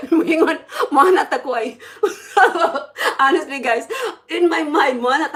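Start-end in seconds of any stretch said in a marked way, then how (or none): 0.1-1.8 loud, laughing through the words; real laughter. Real, not acted. Laughing at the thought in her mind, amused. Cracks up while telling the story. 1.8-3.1 loud, high burst of laughter; real laugh. Real, not acted. Cracking up at her own church story, laughing.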